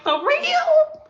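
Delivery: high, stunned "for real?"; incredulous client. Retold or imitated. Client can't believe stuff gets thrown out, high incredulous squeak. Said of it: "They be like".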